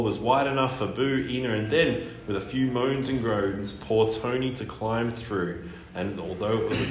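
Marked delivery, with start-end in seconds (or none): none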